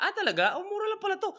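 high, pleasantly surprised; mock surprise. Partner quoted as pleasantly surprised, high-pitched.

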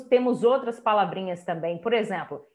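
normal talking voice

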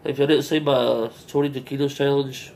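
speaking between bites; talking while chewing. Real, not acted. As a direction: mouth partly full of food. Seen 0: Bite taken at -7, cheeks full and jaw working while talking.

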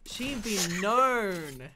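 very slow, drawn-out attempt at the phrase; puzzled sounding-out. Real, not acted. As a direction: stretch each word while working it out. Slowly trying out the puzzling phrase.

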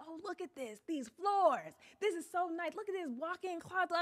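high-pitched mock admiration; imitating admiring husband. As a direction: coo over it in a high mimicking voice. Mimicking husband admiring the house.

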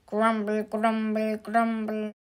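monotone grumpy chant; grumbling. A narrator's reading. Mister Grumble complains as they walk, grumbling. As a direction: grumble along on one note. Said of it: "he grumbled about not having a hobby".

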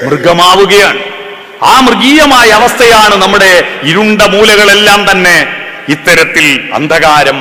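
loud, high sermon voice, slowing on the key lines; full-force sermon delivery; fervent preaching. Acted. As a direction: preach it out to the crowd.

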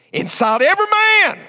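high, loud preaching cry; preacher's soaring shout. Real, not acted. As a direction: belt it out from the pulpit. Preacher building to climax.

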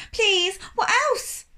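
high, slow desperate plea; pleading. A narrator's reading.

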